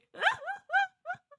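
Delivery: high squealing burst of laughter. Real, not acted. Streamer squeals with laughter at the meowing card, very high. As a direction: squeal with delight.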